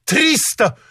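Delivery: high, flatly final pronouncement; peremptory high-pitched official; categorical. A narrator's reading. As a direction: state it as not open to argument. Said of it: "категорически заявил Супругов".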